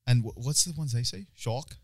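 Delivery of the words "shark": a touch of South African accent; lightly imitated South African vowel. Shown as a demonstration. Joker imitates how South Africans say it, South African accent. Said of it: "an accent and shit like that".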